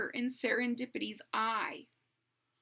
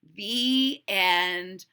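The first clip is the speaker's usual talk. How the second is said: ordinary delivery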